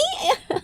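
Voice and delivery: short high-pitched giggle; giggle, caught off guard by praise; flattered squeal of a giggle. Real, not acted. Flattered by compliment.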